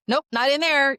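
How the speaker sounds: put-on breezy little child brushing it off; careless child. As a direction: toss it off like a bored kid searching. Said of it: "a child would be like".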